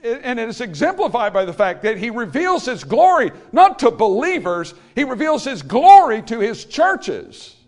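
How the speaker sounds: high, loud, slowed preacher's cry; full-force preaching. Acted. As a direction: proclaim it to the back row with everything.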